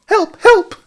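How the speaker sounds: very high, loud squealed cry; Piglet crying out. A narrator's reading. Piglet sees the monster, high panicked cry. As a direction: squeal for help in a tiny, piercing voice. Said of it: "cried Piglet".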